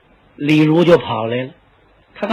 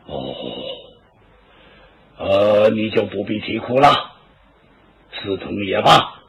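put-on lower, slower voice of an old warlord giving away his concubine; a heavy old lord speaking lower and slower, reluctant; old tyrant, grudging; 3 semitones lower than usual. Dong Zhuo voiced as old tyrant.

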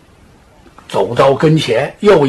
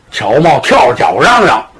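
ordinary delivery, nothing marked

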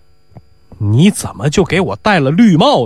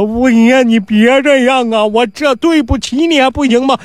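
吓得求饶; 7 semitones higher than usual. Acted. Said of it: "囔鼻子吓得".

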